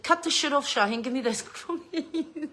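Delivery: mock-stern mother's order. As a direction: lay down the law as mum, cracking into a laugh. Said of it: "Out come the mother voice".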